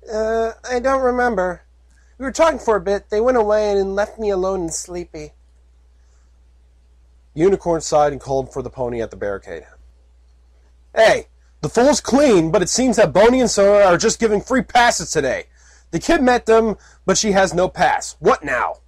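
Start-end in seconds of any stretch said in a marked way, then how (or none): 0.1-5.3 high, slowish dreamy filly; vague filly. Acted. Filly half-remembering the patrol, high and dreamy. Puppy voice held. 10.9-18.8 calling out. Acted. Unicorn calls to the barricade.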